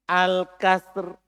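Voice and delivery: "Al-kasr" closed on a bare r; al-kasr without ta. Shown as a demonstration. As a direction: end "Al-kasr" with no vowel after the r. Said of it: "Tidak pakai". (ucapkan "Al-kasr" berakhir di r, tanpa ta)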